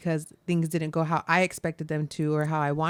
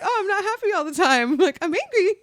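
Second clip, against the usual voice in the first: mock princess; 12 semitones higher than usual. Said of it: "She's like".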